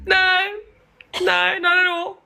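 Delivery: very high, drawn-out no's; high-pitched denial. Retold or imitated. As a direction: squeak out an over-innocent denial. Denying to mom she was drunk.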